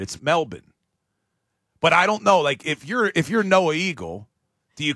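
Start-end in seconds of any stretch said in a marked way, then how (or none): 0.2-0.7 "Melbourne" said MEL-bin; local pronunciation of Melbourne. Shown as a demonstration. Host showing how locals say the city, "Melbourne" as MEL-bin. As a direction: clip the second syllable of "Melbourne" to bin. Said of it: "that's how the locals say it".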